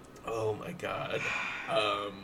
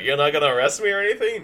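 quick character question; 4 semitones higher, 9 dB louder, much faster than usual. Acted. Banshee asks fast.

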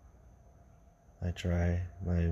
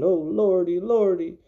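high, loud fearful moan; scared gravedigger moaning; frightened moan; 10 semitones higher, 6 dB louder than usual. Acted. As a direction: groan it out in terror. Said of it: "shook and moaned".

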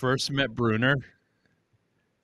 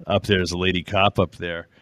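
ordinary talk

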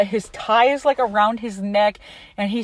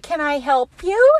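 chirpy clerk imitation; 6 semitones higher, 3 dB louder than usual. Imitating chirpy clerk greeting customer.

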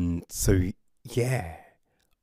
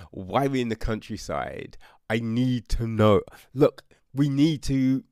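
ordinary talk

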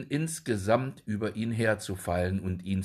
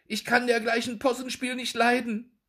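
angry shooing; 14 semitones higher than usual. A narrator's reading. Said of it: "rief sie".

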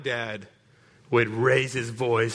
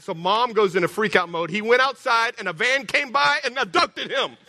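normal talking voice

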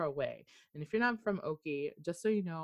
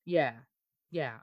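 ordinary delivery

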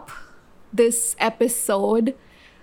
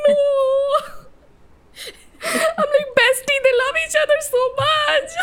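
high-pitched, hammed-up tearful wail; mock bawling. Retelling to friend how she cried at a show, mock bawling. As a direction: imitate yourself bawling over fictional lovers. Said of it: "I started bawling my eyes out".

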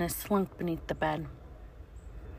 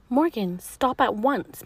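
stern order; 5 semitones higher, 4 dB louder than usual. Acted. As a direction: order her to stop. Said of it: "Mister Z ordered".

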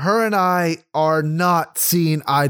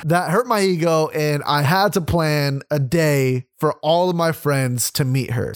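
ordinary talk

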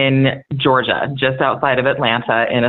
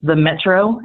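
Southern drawl teacher; 3 semitones higher than usual. Retold or imitated. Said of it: "he sits down and he says".

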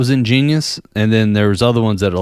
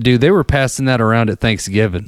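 normal talking voice

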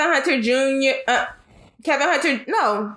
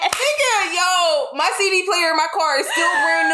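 laughing outburst; 5 semitones higher than usual. Real, not acted. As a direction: laugh and shout it out.

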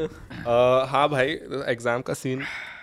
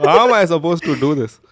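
put-on South Indian accent; 4 semitones higher, 6 dB louder than usual. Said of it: "South Indian just broke through".

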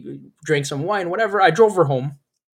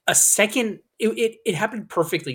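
ordinary delivery

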